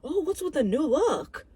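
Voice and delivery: high, exaggerated mock surprise; hammed-up curious coach. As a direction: imitate the coach in a put-on high voice. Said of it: "the coach is like".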